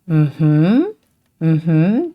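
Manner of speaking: "mm-hmm" dipping, then rising; hummed "mm-hmm" that swings up at the end; English mm-hmm. Shown as a demonstration. Teacher comparing the third tone to English, rising "mm-hmm". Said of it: "that kind of sound".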